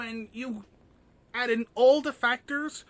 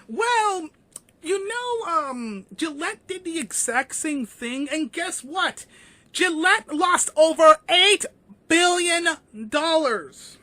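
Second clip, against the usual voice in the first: excited; 6 semitones higher, 8 dB louder than usual. Real, not acted. Gleeful retort about Gillette losses.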